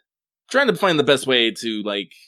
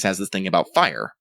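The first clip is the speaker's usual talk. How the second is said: normal talking voice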